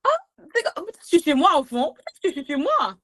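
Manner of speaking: high-pitched mock realization; light, high voice of someone catching on; dawning realization. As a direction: act out a tentative "oh, maybe I belong". Acts out thinking she is home.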